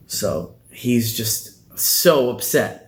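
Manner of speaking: normal talking voice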